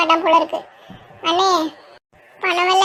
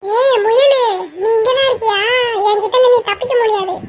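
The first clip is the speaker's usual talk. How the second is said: excited calling out; 4 semitones higher than usual. Acted. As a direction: shout to friends, thrilled at the find. Spotting the rabbit, calling out. (முயலைக் கண்டு உற்சாகமாகக் கூவுதல்)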